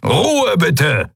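high-pitched call for order; roared appeal for silence; bellowed command. A narrator's reading. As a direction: shout down the uproar. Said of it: "brüllte der Skria-Admiral".

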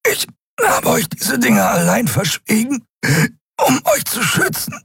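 hoch gepresste, abgehackte Worte; nach Luft ringend. A narrator's reading. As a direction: sprich wie im Würgegriff. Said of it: "Kai rang nach Luft". (atemlos hervorgepresst)

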